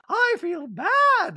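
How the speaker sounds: very high, loud, drawn-out falsetto; hammed-up squeal, stretched slowly; Dorit. Dorit confiding in Garcelle, high mock voice. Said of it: "Dorit whispers to, back to Garcelle".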